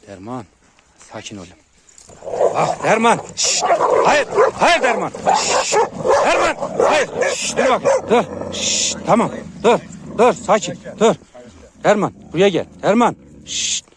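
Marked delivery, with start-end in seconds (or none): 2.5-13.2 real high, loud shouting; forceful shouts broken by sharp shushes; urgent dog commands. Real, not acted. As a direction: shout short orders to stop the dog. Breaking up dogs, shouting commands.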